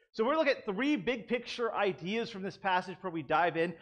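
usual speaking voice

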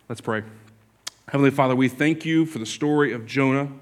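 a pastor praying before the sermon; pulpit prayer. Acted. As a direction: pray gently over the congregation. Leads the congregation in prayer.

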